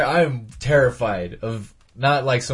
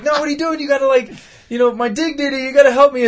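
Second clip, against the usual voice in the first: high, pleading voice; comic ghost begging his friend, high and pleading; 11 semitones higher, faster than usual. Retold or imitated. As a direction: beg in a squeaky ghost voice. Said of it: "the ghost is like".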